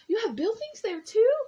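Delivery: high and loud, astonished; can't believe there are buildings; high, loud wonder at a tiny town; gasping amazement. A narrator's reading. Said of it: "Horton gasped".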